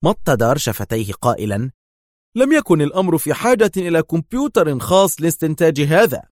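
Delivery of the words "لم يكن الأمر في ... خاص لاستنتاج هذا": high, loud dismissive scoff. A narrator's reading. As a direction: brush it off with contempt. Dar scoffs, pursed lips.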